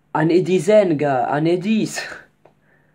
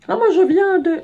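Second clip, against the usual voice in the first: squeaked out, much higher; high squeaky reply; 6 dB louder than usual. Retold or imitated. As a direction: voice the other man in a high squeak. Voices the other man, high. (réponse aiguë et couinante)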